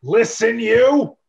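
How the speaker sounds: loud, high angry bark; furious hero snapping at Toad; angry order. Acted. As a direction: grab him and shout in his face.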